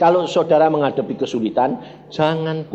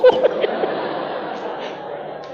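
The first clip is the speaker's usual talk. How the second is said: airy laugh. Real, not acted. Preacher laughs after the punchline.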